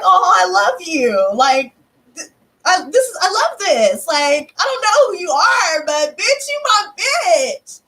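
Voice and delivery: high, hammed-up gush of love; gushing affection. Shopper to a stranger she just hugged, gushing. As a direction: gush giddily, stretching the last words high.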